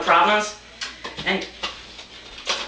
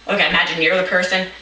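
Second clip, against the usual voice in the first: usual speaking voice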